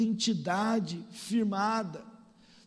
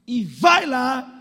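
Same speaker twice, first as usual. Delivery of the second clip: loud, drawn-out send-off; urging mother; 3 semitones higher, 11 dB louder, much slower than usual. Retold or imitated. Mother sends Jacob to take his brother's blessing, urging loudly. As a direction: push him out the door, loudly.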